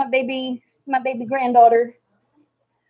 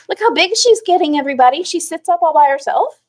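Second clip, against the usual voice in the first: excited; 6 semitones higher, 3 dB louder than usual. Real, not acted. Showing grandbaby to viewers.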